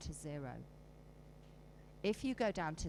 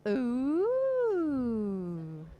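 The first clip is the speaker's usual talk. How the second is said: loud high ooh from the room; 5 semitones higher, 9 dB louder than usual. Shown as a demonstration. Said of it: "Who noticed".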